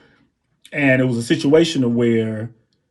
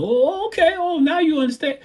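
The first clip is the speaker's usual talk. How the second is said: [name]; 14 semitones higher than usual. Retold or imitated. Singer approving the drummer's groove, imitated high voice. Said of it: "and he's like".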